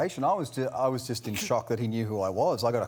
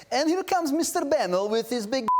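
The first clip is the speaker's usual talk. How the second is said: put-on high-pitched comic Russian accent; 10 semitones higher than usual. Actor mimicking colleague's arrival at work, high mock Russian accent. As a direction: announce him in a hammed-up Russian accent. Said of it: "why did it have to be Russian".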